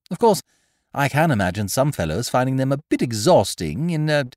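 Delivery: put-on breezy young master; young gentleman. A narrator's reading.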